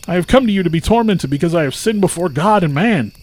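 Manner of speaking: high, loud pleading cry. A narrator's reading. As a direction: beg loudly at the gates. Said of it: "He cried out to the Prince of Hell".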